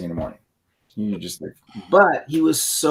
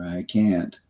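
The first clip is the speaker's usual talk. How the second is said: defeated; 8 semitones lower, 5 dB quieter than usual. Shown as a demonstration. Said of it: "these tonal postures".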